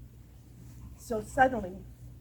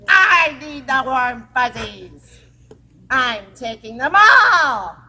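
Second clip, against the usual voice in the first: slow, loud menacing witch voice; the witch demanding the children's warm fuzzies, slow and loud; 15 dB louder, slower than usual. Acted. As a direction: drag out each threat like a greedy witch. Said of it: "the witch said".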